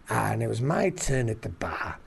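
normal talking voice

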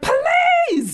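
sudden high shout at the man behind; yell. Retold or imitated. Woken on a plane, yelling back at a seat-puncher. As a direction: bark it out, jolted awake and fed up. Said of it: "I was like".

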